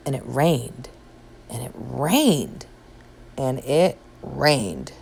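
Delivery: storyteller slowing the rain; slowing repetition. A narrator's reading. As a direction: let the rain drag on and on.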